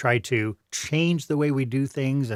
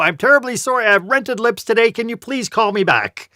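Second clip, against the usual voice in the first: higher, louder, a flustered caller tripping over words; flustered voicemail apology; 8 semitones higher, 5 dB louder than usual. Pretend. Caller apologizing on a voicemail, higher and louder, flustered. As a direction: play a caller embarrassed on a voicemail. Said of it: "you trip all over your words and you go".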